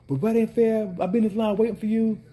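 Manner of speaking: high, loud whining customer voice; mock an indignant customer, pitched high; whiny customer. Vendor imitates customers upset he stopped serving, high whine. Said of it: "customer protest".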